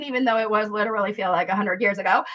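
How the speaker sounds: ordinary delivery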